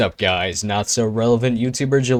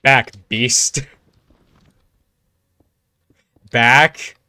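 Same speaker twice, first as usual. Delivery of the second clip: loud, urgent warning shout; 7 dB louder, much slower than usual. Real, not acted. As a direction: yell at an enemy to back off. Warding off an attacking player.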